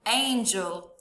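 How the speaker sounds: normal talking voice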